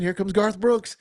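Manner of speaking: lightly put-on country radio intro; mild mock country-DJ announcement of the next song. Shown as a demonstration. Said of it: "just how I talk when I'm on the radio".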